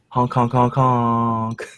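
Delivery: goofy clown horn honks; silly clown honking. Acted. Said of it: "who are you honking at".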